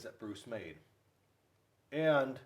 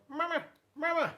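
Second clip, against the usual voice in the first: very high, chirpy gosling calling Mama; baby goose voice. Acted. Goslings calling after grumpy bear Bruce, high and chirpy. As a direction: pipe it up like a tiny gosling calling its mother. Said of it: "chased after him going".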